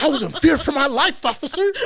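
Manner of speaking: high, laughing fake innocence to a cop; laughing mock plea. He tells the officer he feared for his life, laughing. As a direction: play the innocent victim while laughing.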